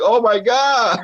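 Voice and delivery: put-on high gasping kid exclamation; shocked kids. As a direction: gasp like a crowd of schoolkids. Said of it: "The kids are like".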